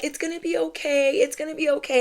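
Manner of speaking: high, gentle reassurance; soothing nurses. Retold or imitated. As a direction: imitate kind nurses cooing comfort. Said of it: "everybody was being so nice to me".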